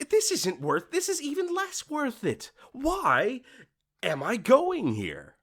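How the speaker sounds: high, fed-up complaint slowing at the end; fed-up inner voice, pitched high; exasperated disbelief. Pretend. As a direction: throw up your hands at the prices. Imagined exasperated thought.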